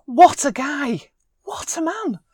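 high, drawn-out swoon; gushing admiration. Real, not acted. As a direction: gush it slowly, pitch soaring. Gushing over Bradley Cooper.

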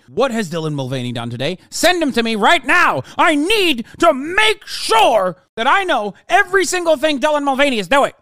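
hammed-up demanding boss voice; mock frantic boss. Streamer mocking a pundit ordering his interns around, high and loud. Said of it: "interns going".

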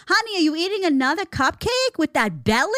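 lightly voiced belittling wife; mock the husband sweetly; nagging wife. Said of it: "a whole room full of people".

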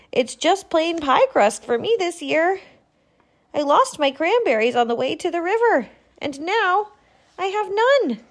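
high, quick, a touch of dismay; sad porcupine. Acted. Porcupine tells her friends she lost her cranberries, mildly dismayed. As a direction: confess the loss a little forlorn.

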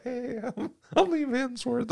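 high, mocking little intro; mock Liam Hemsworth. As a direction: pitch it up like a nervous new guy. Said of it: "the poor guy's gonna be like".

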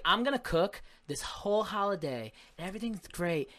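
lightly voiced mom, cheerful then weary; hammed-up mom planning the holiday; mocking mom. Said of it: "She'll be like".

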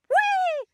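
high whee. Acted. Witch tossing a tree with a high whee.

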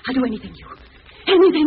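high, loud desperate promise; desperate cry. Acted. Lona agreeing to the killer's terms, in desperate fear. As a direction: give in completely.

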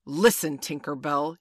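loud, commanding reproach; stern cry. A narrator's reading. Peter confronts Tinker Bell over her crime, in a loud stern cry. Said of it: "he cried".

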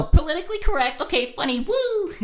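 high-pitched cheering, up to a woo; mock cheer. Comic recapping the joke, mock cheering. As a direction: go high and whoop it at the end.

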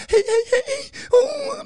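high squealing giddy hey hey; mock giddy squeal. Mocking the podcast hosts backstage in a high giddy squeal. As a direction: squeal like an overexcited fan.

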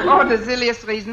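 quick mock-German repeat; put-on German accent. Fake applicant dismissing her firing, quick put-on German accent. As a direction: toss it off fast in a German accent. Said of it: "If I can do a decent German accent".

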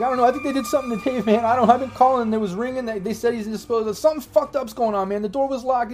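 high-pitched, agitated rambling; rambling drunk guy. Acted. Drunk guy behind the gunman rambles on, high and agitated. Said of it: "like, rambles on".

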